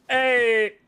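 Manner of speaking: sudden loud high shout; startled loud hey. Real, not acted. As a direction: bark out a call to someone. Sudden call out in game.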